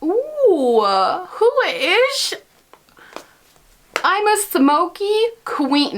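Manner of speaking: high, put-on squeal of self-admiration; hammed-up high queenly cooing; mock diva. Vlogger showing off her finished smoky look, mock diva voice.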